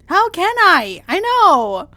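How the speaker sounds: high, loud despair; loud pained protest; anguished cry. Acted. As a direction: break out in grief. Asked to smile while he dies.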